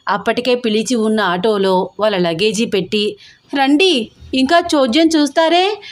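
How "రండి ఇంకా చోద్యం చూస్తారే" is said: shouting. A narrator's reading. Aunt yelling at her husband to come along, higher. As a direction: call him out sharply, raised and high. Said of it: "అరుస్తోంది".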